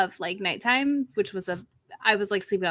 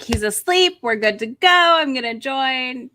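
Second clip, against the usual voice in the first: excited; 4 semitones higher, 7 dB louder than usual. Retold or imitated. Retold thought about sleeping dog.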